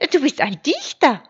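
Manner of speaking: high, loud, amazed sheep; surprised cry. A narrator's reading. Sheep discovering the wolf is a poet, surprised cry. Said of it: "Schaf überrascht".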